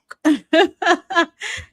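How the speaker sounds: loud, high laugh with a crying edge; real pained laugh. Real, not acted. Host laughing about the platform coming down on her. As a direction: laugh it off half in pain.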